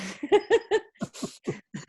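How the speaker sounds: high giggling laugh; real laugh at a teasing joke; amused laugh. Real, not acted. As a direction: burst out giggling. Laughs at joke about little legs.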